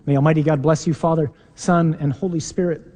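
ordinary talk, nothing marked

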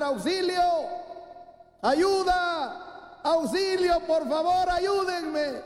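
high, frantic cries for help; drowning man's cries. Acted. Preacher acting the friend in the river, yelling for help. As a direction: shriek it like a man going under. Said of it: "empezó a gritar".

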